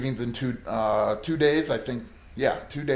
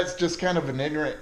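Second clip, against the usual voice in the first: ordinary talk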